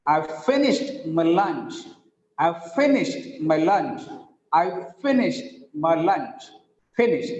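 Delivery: "finished" stressed on "fi", then "lunch" stressed; measured drill stressing "finished" and "lunch". Shown as a demonstration. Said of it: "Fi is stressed".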